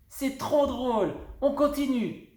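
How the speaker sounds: high and giddy; bouncing with glee; excited. A narrator's reading. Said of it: "en sautant sur place comme une puce". (fillette surexcitée, voix aiguë)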